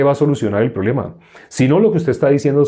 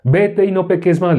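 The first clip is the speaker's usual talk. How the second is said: higher, imitated moral order; mock religious command; 7 semitones higher than usual. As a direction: imitate a hypocrite ordering a sinner. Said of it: "le dice".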